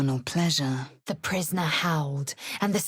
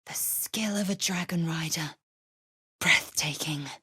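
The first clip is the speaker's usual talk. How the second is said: soft hushed whisper; breathless awed whisper; 4 dB quieter than usual. Acted. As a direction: whisper in wonder. Awed at dragon.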